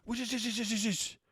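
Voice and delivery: high mock hushing voice; girlfriend hushing. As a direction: squeak out a frantic shush.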